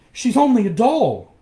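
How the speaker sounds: high, loud cry of dismay; dismayed exclamation. A narrator's reading. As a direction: exclaim at a shocking discovery. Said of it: "he exclaimed in dismay".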